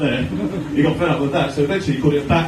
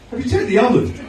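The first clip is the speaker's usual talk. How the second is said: deadpan reply; 6 semitones higher than usual. Acted. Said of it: "And I said".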